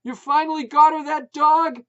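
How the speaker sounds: high, loud shout. A narrator's reading. Putz shouting at wife over barking, loud. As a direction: bellow the question.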